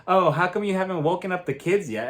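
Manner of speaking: ordinary talk, nothing marked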